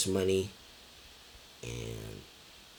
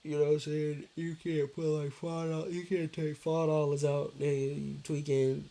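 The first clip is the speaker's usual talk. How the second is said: higher mock voice of an irritated girlfriend; mock girlfriend scolding, higher; imitating upset girlfriend; 8 semitones higher than usual. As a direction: put on her exasperated tone, pitched up. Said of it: "She's like".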